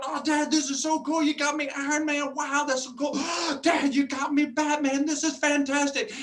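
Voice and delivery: put-on high, bright kid voice, gasping with delight; excited little son. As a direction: play the thrilled young son opening his toys. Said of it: "He's going".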